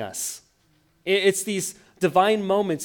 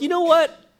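defiant outburst; 13 semitones higher, 11 dB louder than usual. Retold or imitated. Believer stepping out of alignment.

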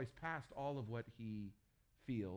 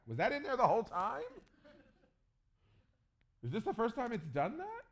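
much higher and louder, freaked-out disbelief; startled panic; 12 semitones higher, 9 dB louder than usual. Pretend. Moses staring at the staff-snake, startled panic. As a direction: yelp it like you just saw the snake.

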